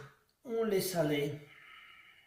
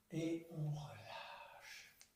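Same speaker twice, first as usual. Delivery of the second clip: soft release; 3 semitones lower, 9 dB quieter, much slower than usual. Shown as a demonstration. Yoga release on the exhale.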